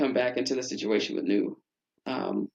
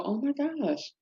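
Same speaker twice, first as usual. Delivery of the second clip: amazed parent; 10 semitones higher than usual. Retold or imitated. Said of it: "the parents just go".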